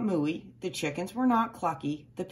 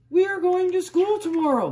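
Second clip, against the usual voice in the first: high, loud excited announcement; excited farmer announcing; 11 semitones higher, 6 dB louder than usual. A narrator's reading. Farmer telling the cows, high and loud with excitement. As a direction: call it out high and bright with excitement. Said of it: "he told the cows".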